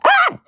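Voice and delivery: very high mock scream of excitement. Friend imitates Hannah screaming at sprites. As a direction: shriek like catching a rare sprite. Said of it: "That's my guess at my impression".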